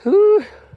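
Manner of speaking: high, loud breathless whew; effort grunt. Real, not acted. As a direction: blow out hard from the effort. Exertion near the top. (hingal na buga ng hangin)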